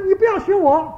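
put-on high-pitched retort of a cross child; mock scolding. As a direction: act out a child telling off a copycat. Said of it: "结果他就骂他". (模仿孩子高声斥责)

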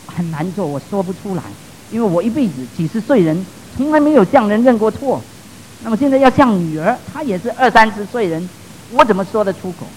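soft, reluctant protest; retold reluctance. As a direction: voice a father's hesitant admission, softly. Retold father reluctant to apologize. (模仿为难、低声推托的语气)

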